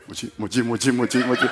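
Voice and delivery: quick repeated name, starting almost voiceless; hushed urgent call. Retold or imitated. Preacher acting out his plea to the helper for rescue, hushed urgent call. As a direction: summon help discreetly, acting it out.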